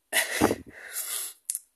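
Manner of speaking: loud, breathy burst of real laughter; sudden loud laugh. Real, not acted. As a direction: crack up out loud at yourself. Laughs at calling himself a loser.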